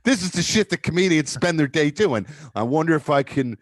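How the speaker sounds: normal talking voice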